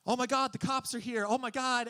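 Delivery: high mock panic; faked alarm. Teen faking a police alarm to empty the house, mock panic. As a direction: pretend the cops just arrived.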